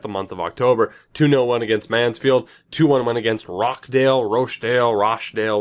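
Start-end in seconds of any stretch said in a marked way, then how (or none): 3.5-4.2 "Rockdale" with a hard k, ROCK-dale; guessed pronunciation. Real, not acted. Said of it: "whatever the hell". 4.2-4.9 "Rochdale" as ROHSH-dale, long "oh" and "sh"; second guess. Real, not acted. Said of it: "whatever the hell". 4.9-5.6 "Rochdale" as RAHSH-dale, open "ah" and "sh"; third guess. Real, not acted. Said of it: "whatever the hell".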